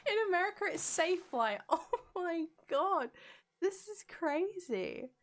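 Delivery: high-pitched, incredulous exclaiming; amazed. Real, not acted. Streamer learning America has its own version, amazed. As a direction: react as if floored by the discovery. Astonished by yet another brand name.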